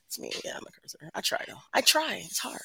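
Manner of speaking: usual speaking voice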